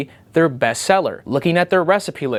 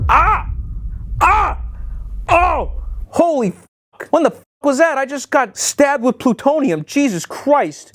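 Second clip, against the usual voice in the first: pained yelps; 10 semitones higher than usual. Real, not acted. Cut on the bottle rim, yelping in real pain. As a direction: cry out as the glass cuts you.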